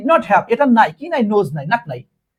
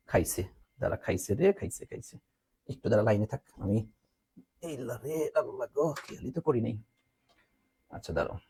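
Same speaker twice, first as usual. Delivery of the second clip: quiet aside; 8 semitones lower, 12 dB quieter than usual. Real, not acted. Phone dying, aside off mic.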